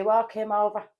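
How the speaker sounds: retold call-out. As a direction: call it out like mum giving orders. Retold mother's order about dad.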